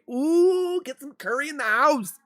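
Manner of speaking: high-pitched, put-on excited squeal; playful mock cheer. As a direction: hype up dinner in a silly high voice. Playfully cheers curry for dinner.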